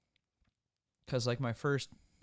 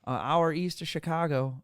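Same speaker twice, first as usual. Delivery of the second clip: mock deep Southern accent; 4 semitones higher, 9 dB louder than usual. Imitating a factory contact placing his town, mock Southern accent. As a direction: drag it out in a big put-on Southern accent. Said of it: "he talked with a deep Southern accent".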